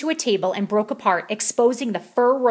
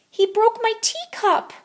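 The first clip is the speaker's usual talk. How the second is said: put-on high, slow whining child; whine; 14 semitones higher than usual. A narrator's reading. Jilly Boo telling on Skippy, high slow whine. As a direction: whine it to mama. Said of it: "whined Jilly Boo".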